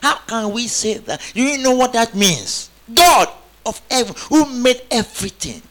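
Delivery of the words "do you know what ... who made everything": preaching at full force. Real, not acted. Preaching climax.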